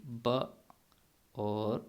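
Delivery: British ب with a glottal t, then بوف with an f; British accent. Shown as a demonstration. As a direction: clip the t, turn the th into f. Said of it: "ان کو بھی".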